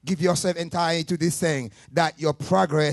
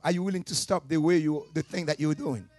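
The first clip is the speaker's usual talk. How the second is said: ordinary talk, nothing marked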